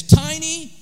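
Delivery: preaching build. Real, not acted.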